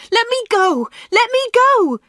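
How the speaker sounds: very high, desperate loud cry. A narrator's reading. Anna struggling to get away from Dave, crying out. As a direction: cry out to be released, pitch soaring. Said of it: "she cried".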